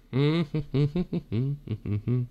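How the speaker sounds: drawn-out closed-mouth chuckle; long chuckle. Real, not acted. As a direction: keep chuckling to yourself. Laughs after singing Venus line.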